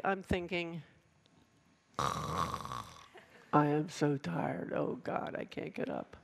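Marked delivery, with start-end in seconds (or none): 2.0-6.1 very slow, low and exhausted, trailing into a breath; worn-out sigh, voice fading to a whispered "Oh, God"; weary morning groan. Retold or imitated. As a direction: drag it out as if too tired to rise. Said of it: "this is how it goes".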